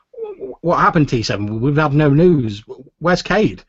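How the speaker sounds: high, loud, stammered questions; anxious questioning. Acted. Inigo asks T seven about missing Cade, anxious and urgent. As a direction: urgently press for news, voice jumping up. Pilot begs news of missing friend.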